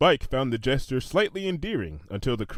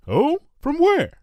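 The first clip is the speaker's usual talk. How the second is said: high, louder curious question; curious Lyra; 6 dB louder, slower than usual. A narrator's reading. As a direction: ask it bright and much higher. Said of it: "Lyra asked".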